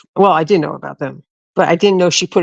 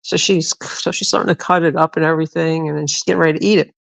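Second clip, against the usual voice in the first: normal talking voice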